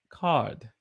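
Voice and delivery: British "Card" with no R; attempted British accent, R dropped in "Card"; British pronunciation. Shown as a demonstration. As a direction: say "Card" like "cahd", imitating British. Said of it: "some British folk may say".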